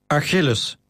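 a firm, commanding call of the name; stern. Acted. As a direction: order him like a master to a slave. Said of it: "klonk Maximus' stem streng".

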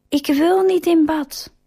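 put-on high, whiny child protest; whiny refusal. A narrator's reading. Josué protesting to his mother, whiny. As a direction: push back like a sulky kid.

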